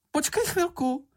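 very high squeaky call; Čenich shouting. A narrator's reading. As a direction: squeal for them to wait. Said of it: "zakřičel Čenich". (pisklavé zavolání)

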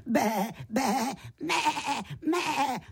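high bleating exchange; sheep and goat bleats. A narrator's reading. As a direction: trade high bleats, lamb to kid. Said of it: "metal si to za kozlíkem". (vysoké bečení a mečení)

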